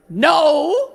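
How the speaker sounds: high outraged no; mock outraged no. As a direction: cry out like an offended churchman. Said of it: "all the men were like".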